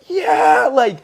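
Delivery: high, loud burst of joy; excited yell. Retold or imitated. Coach cheering his dancer's win, high excited yell. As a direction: shout it like your guy just won. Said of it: "I was like".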